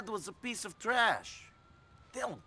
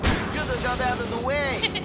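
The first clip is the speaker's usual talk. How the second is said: loud angry yell out the window; 6 semitones higher, 9 dB louder than usual. Acted. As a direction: shout at the driver who cut you off. Yelling at another driver.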